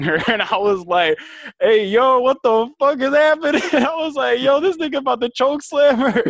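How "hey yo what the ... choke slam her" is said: high, loud, hyped-up shock; alarmed outburst. Retold or imitated. Retelling seeing a neighbour grab her neck, loud shock. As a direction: re-enact it at full excitement, pitch way up.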